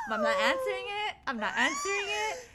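loud, high, frantic wail; retold panic, shrieked high and fast; panicked refusal. Panicked refusal to answer phone.

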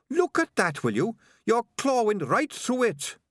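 high-pitched, indignant little rat; Sardines the rat. A narrator's reading. Rat showing his clawed hat, high-pitched complaint.

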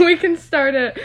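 laughing while speaking. Real, not acted. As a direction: giggle the words out.

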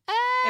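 put-on high, loud girlish scream; a quick shrill shriek of fright; mock scream. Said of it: "she's like".

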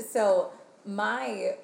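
usual speaking voice

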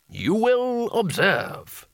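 high, very drawn-out showman voice; showman's patter. Acted. Wizard addresses his audience before the spell, theatrical and drawn-out. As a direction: stretch it out grandly for the audience. Wizard performing like a stage magician.

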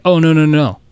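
high, loud alarmed no no no; panicked no. Retold or imitated. Workers stopping the wrong load, high alarmed protest.

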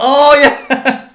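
gleeful cheer. Retold or imitated. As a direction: belt it out like a thrilled fan. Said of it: "It's like".

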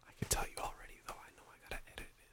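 very soft, quick whisper. Real, not acted. Telling co-host about the edit, hushed whisper. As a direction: whisper it under your breath. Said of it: "stop whispering".

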